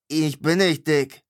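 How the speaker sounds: indignant barkeep denial; offended retort. A narrator's reading. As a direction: bristle at the insult. Innkeeper offended at "fat.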